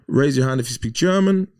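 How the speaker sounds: high, loud announcing voice; German passenger taking charge of the stranded bus; German woman taking charge. Retold or imitated. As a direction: address the whole bus like a self-appointed leader. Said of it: "she goes".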